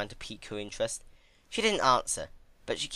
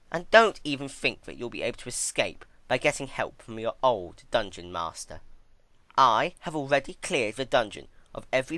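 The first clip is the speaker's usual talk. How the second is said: higher, louder, menacing villainess; gloating threat; 4 semitones higher, 5 dB louder than usual. Acted. Twilight threatening Celestia with death, higher gloating. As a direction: savour telling her she'll never leave.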